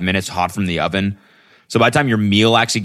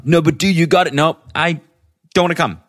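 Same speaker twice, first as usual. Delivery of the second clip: higher-pitched mock insistent friend, then refusing; pestering friend; 10 semitones higher than usual. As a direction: act out the back-and-forth of nagging and saying no. Voices a pushy friend, then refusal.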